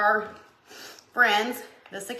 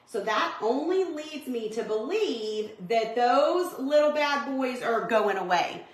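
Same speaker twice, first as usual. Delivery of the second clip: ordinary talk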